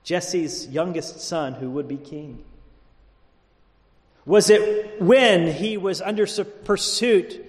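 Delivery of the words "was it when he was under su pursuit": high-pitched rhetorical question; raised pulpit voice; impassioned preaching. Real, not acted. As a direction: press the question on the congregation.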